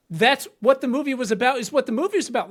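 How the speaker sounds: put-on high, loud exasperated rant at a know-it-all kid; exasperated outburst. Wife snapping at son over Barbie, a loud exasperated rant. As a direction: blow up at the kid in disbelief. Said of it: "My wife was like".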